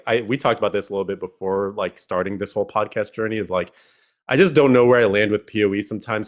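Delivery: ordinary talk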